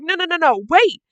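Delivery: high, loud frantic cry to stop; screamed plea. Acted. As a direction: scream it out in character as a desperate plea.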